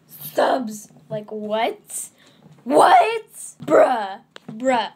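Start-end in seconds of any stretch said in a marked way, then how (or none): 2.6-3.3 high loud disbelieving what; shocked. Real, not acted. Vlogger sees her subscriber count, high shocked shout. 3.6-4.9 loud bruh. Real, not acted. Reaction to subscriber count.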